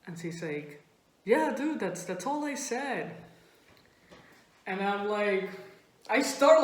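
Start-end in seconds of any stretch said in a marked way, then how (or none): 1.2-3.1 mildly unsure, stumbling insistence; hesitant friend. Retold or imitated. Friend answering after a long pause, hesitant. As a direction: answer as if after careful thought. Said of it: "a five-second pause to think about it".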